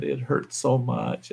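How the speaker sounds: soft, slow, whiny complaint; softly moaning wife, mocked; mock whining. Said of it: "she's whining and bitching".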